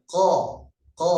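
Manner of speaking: "ko" made far back, near the throat; isolated "ko", tongue root lifted toward the throat; throat-near qaf. Shown as a demonstration. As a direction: say "ko" as a heavy Arabic uvular q. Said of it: "Lebih dekat dengan tenggorokan".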